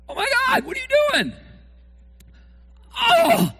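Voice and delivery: high, loud shocked yell; mock outraged fan. Preacher plays a fan yelling at an interception. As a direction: yell at the quarterback in disbelief.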